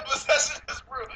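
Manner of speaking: high-pitched amused repeat through laughter; laughing echo. Real, not acted. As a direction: echo the claim back, cracking up. Repeats co-host's claim while laughing.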